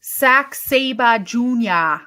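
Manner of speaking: put-on slow, drawn-out Massachusetts accent. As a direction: stretch the name out Boston style. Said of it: "That is the best accent".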